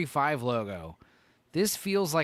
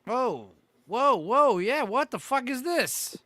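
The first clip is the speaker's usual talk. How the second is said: shocked; 9 semitones higher, 4 dB louder, slower than usual. Real, not acted. Shocked at the hidden Toyota.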